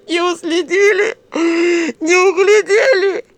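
high, loud, drawn-out howls; tearful wailing. A narrator's reading. Mother blames herself for daughter, wailing. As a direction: lament slowly at full cry. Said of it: "подвывала мать, роняя слёзы".

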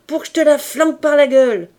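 fast, loud furious threat. A narrator's reading. Lisa threatening a maid in fury. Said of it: "continuait Lisa furieuse".

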